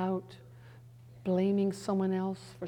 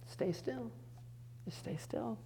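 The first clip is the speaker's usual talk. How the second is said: much softer, soothing; gentle command; 3 semitones higher, 12 dB quieter, slower than usual. Pretend. God calms the anxious woman, soft and hushed. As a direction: hush her like a calming parent. God's voice spoken to her.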